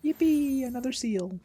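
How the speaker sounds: high, gleeful cheer; excited. Real, not acted. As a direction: squeal with delight. Gets an item.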